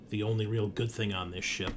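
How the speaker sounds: usual speaking voice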